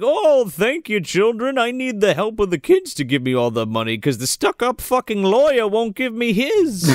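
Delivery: hammed-up Santa gushing; grateful Santa. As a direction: Santa thanks the children for their savings. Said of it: "he's like".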